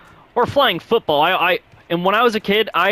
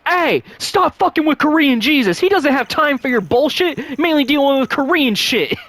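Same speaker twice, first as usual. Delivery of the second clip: movie quote impression; 10 semitones higher than usual. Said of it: "my most favorite part is".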